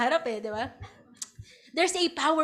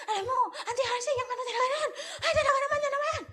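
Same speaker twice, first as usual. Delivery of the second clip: higher, fast, laughing complaint; agitated woman, laughing; 11 semitones higher, much faster than usual. Retold or imitated. Disciple complaining to preacher's mother, high laughing chatter. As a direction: rattle it off high and giggly.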